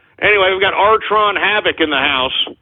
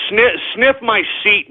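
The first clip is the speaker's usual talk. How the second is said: ordinary talk, nothing marked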